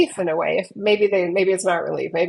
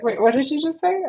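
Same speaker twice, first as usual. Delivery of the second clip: high-pitched, questioning client imitation; higher-voiced mock puzzlement; puzzled client; 7 semitones higher than usual. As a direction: lightly mimic a client doing a double take. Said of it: "they look at me like".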